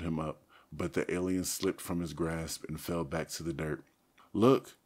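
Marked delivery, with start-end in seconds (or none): 4.3-4.8 high, loud alarmed cry; urgent cry. A narrator's reading. Cassie points the others to the wound, crying out. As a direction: call out to make them see. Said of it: "Cassie cried".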